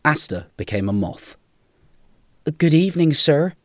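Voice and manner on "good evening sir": higher, louder, eager-to-serve voice; higher-pitched, deferential greeting; polite young Malcolm. A narrator's reading.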